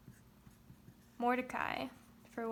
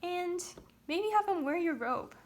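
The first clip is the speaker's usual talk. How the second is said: gleeful suggestion; 6 semitones higher, 4 dB louder than usual. Retold or imitated. Haman voiced, thinking it's for him.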